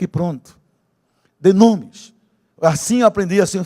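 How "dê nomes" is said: "Dê nomes" shouted high, both words hit hard; loud command. Shown as a demonstration. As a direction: bark "Dê nomes" at the church.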